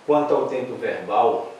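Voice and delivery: usual speaking voice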